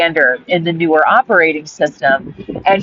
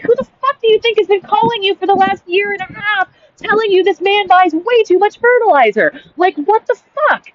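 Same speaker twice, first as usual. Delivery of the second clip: much higher, exasperated outburst; the girlfriend blasting the FBI agents, higher and indignant; retold outrage; 11 semitones higher than usual. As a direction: voice climbing high in disbelief. Woman arrested after warning FBI.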